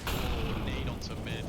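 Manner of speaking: loud sudden grenade call; excited call. Real, not acted. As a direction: burst out at the nade.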